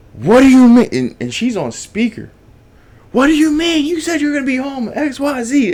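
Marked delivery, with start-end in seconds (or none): 0.1-0.9 loud, high-pitched indignant outburst; retold screaming. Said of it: "starts screaming at him". 3.1-5.7 high-pitched mock girlfriend tirade; retold screaming. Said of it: "starts screaming at him".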